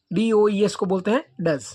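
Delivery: ordinary delivery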